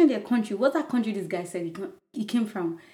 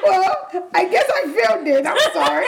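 laughing; 8 semitones higher, 5 dB louder than usual. Real, not acted. Admits failing the game, laughing.

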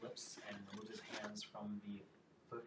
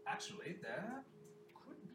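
lightly voiced minstrel, higher pitch; minstrel figure; 9 semitones higher than usual. Hystrom answers the queen before the court, lightly voiced minstrel. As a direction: offer it up with a rising lilt.

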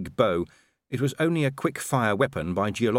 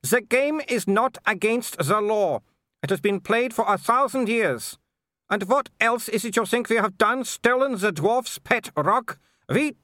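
high-pitched imperious aristocrat; scornful Baroness; 12 semitones higher, 4 dB louder than usual. A narrator's reading. Baroness scorns the threatening visitors, high and haughty. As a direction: look down on them, voice pitched far up.

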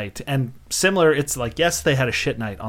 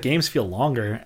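usual speaking voice

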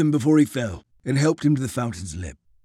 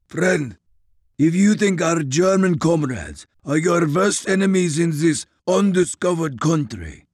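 put-on stern, clipped orders with Russian accent; commanding Russian officer; 5 semitones higher, 5 dB louder than usual. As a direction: lay down orders, no debate. Grigori commands.

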